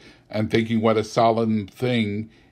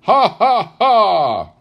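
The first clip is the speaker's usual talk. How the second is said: loud, high voiced laughter rolling on; hearty laugh; 11 semitones higher, 12 dB louder, much slower than usual. A narrator's reading. Nephew laughing loudly among his Christmas guests. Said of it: "laughed Scrooge's nephew".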